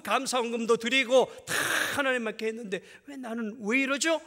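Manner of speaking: high, loud aggrieved protest to God; complaining believer. Retold or imitated. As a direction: whine it at God like a wronged believer, then cry out.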